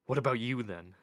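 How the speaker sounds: mildly irritated retort, loud at the start; sharp, understated comeback; snapping. A narrator's reading. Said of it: "snapped Mort".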